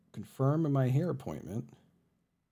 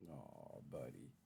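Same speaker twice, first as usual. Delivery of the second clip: slow, much softer, fond coo; tender aw; 9 semitones lower, 20 dB quieter, slower than usual. Real, not acted. Streamer cooing fondly to her purring cat, slow and soft. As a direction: melt over the cat slowly.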